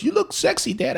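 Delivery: very high-pitched mock son voice; teasing son. Son teasing dad about his glasses selfie, high mock voice. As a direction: squeak out a playful compliment. Voicing son's reply to selfie.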